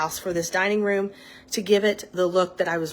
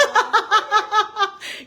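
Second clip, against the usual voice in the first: squealing laugh; high burst of laughter; 14 semitones higher, 6 dB louder than usual. Real, not acted. Laughs at dog-voice joke.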